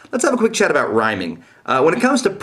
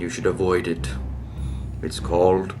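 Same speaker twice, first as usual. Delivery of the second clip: eerie whisper; 5 semitones lower, 8 dB quieter than usual. Acted. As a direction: breathe it like a dark secret. Mock mysterious reveal.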